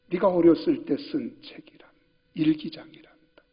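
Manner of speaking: soft whispered father's voice; tender whisper. Retold or imitated. Old father whispers tenderly to his son, showing the diary. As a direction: hush it like reading an old secret.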